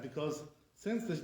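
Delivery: ordinary delivery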